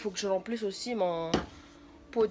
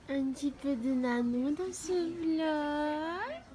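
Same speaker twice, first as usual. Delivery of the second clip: whispering over a crib; 6 semitones higher, much slower than usual. Acted. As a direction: coo it gently to a sleeping pet. Cooing at sleeping dog.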